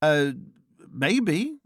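hesitant, uncertain rising answer; pause, then answer vaguely with a lift. A narrator's reading. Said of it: "I said vaguely".

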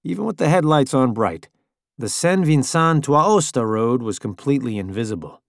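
plain reading voice

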